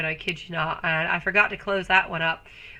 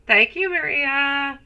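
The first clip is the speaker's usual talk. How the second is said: slow, higher sweet thank you; cooing thanks; 5 semitones higher, slower than usual. Acted. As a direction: draw out a sugary thank-you. Thanking viewer.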